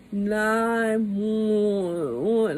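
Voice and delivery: words dragged out and barely formed; slurred stroke speech. Retold or imitated. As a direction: imitate slow, drawn-out slurring. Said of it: "talking like that".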